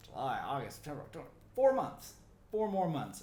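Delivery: high, loud excited burst. Real, not acted. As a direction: get fired up about the wait. Excited countdown to reveal.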